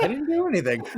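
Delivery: high-pitched denial through laughter; laughing protest. Real, not acted. Co-host blamed for lost train of thought, laughing protest.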